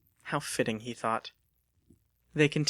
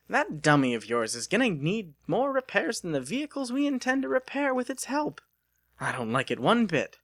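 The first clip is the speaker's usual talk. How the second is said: put-on higher, scolding older woman; grumbling landlady; 9 semitones higher than usual. Missus Bittersworth objects to Lucas's robot, higher scolding voice. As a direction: complain about the useless robot.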